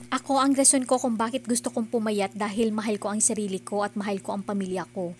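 ordinary talk

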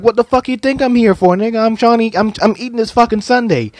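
a hint of a cranky Beerus brushing Frieza off; annoyed Beerus. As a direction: toss off an irritated retort with a mouth full of sundae. Beerus annoyed, interrupted while eating.

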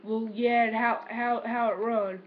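normal talking voice

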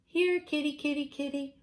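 high sing-song kitty call; calling a cat. Acted. Children calling their cat in a high sing-song. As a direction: coax the cat sweetly.

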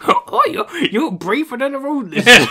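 Owen Wilson impression. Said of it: "he's like".

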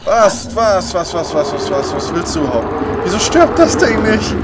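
panicked shouting. Real, not acted. Panicked gamer attacked by monster.

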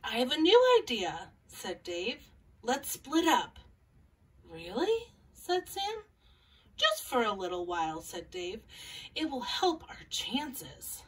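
gentle picture-book read-aloud with small character voices; calm storybook reading for young listeners; reading to children. Acted.

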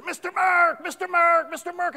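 high, loud urgent call for help; crying out. Retold or imitated. Third grade teacher calling a parent to a choking child, crying out. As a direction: imitate a teacher frantically calling out. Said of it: "she's crying out for me".

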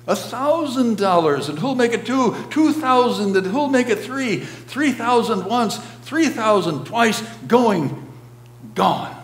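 high, loud bidding chant; excited auctioneer. A narrator's reading. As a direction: drive the bids up to the room. Auctioneer taking rising bids.

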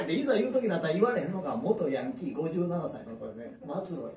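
ordinary talk, nothing marked

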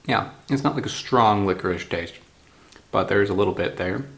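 talking between chews; chewing while talking. Real, not acted. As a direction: mouth partly full of candy. Seen 0: Bit licorice piece at -3; mouth works while talking after.

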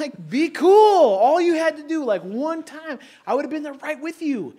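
a betrayed cry at a friend; incredulous yell. Retold or imitated. Yelling at the co-worker who caved in. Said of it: "I just remember looking at you like".

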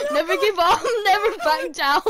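chanting through laughter; giggling the words; laughing. Real, not acted.

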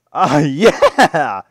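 loud, high whoop of victory; triumphant yell. Real, not acted. Player celebrating a saved cow, triumphant yell. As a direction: let out a big celebrating "yeah".

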